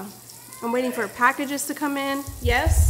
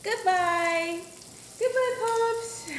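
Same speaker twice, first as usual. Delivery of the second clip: much higher, drawn-out goodbye; high sing-song; 9 semitones higher, much slower than usual. Real, not acted. Waving baby off.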